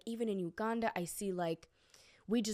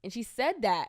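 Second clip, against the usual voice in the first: ordinary talk, nothing marked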